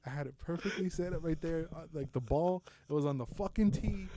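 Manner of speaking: ordinary talk, nothing marked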